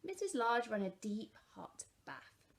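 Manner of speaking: ordinary delivery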